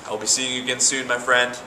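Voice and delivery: ordinary talk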